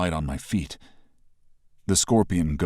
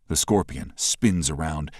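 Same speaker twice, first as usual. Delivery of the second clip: ordinary delivery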